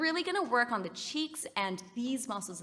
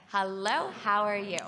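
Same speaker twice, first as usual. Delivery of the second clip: speaking to a steady beat; natural speech on the beat; rhythmic speech-like; 5 dB louder than usual. Shown as a demonstration. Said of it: "a little bit more speech-like".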